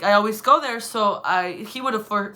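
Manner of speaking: ordinary talk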